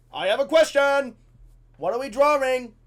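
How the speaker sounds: loud, high blurted question; yelled classroom interruption; shouting pupil. Retold or imitated. Eager pupil interrupting, acted loud.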